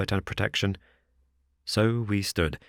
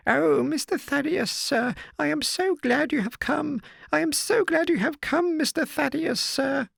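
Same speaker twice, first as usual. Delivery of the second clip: put-on much higher old woman's voice, overjoyed; old housekeeper rejoicing. A narrator's reading. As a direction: gush with relief like an old servant. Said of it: "her reiterated rejoicings".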